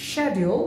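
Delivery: schedule said as SHED-yool; British pronunciation. Shown as a demonstration. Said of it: "British উচ্চারণ হচ্ছে". (schedule British ঢঙে শেডিউল)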